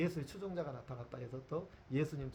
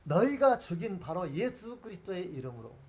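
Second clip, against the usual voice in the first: bold declaration; 4 semitones higher, 8 dB louder than usual. Acted. Peter's bold defense continues.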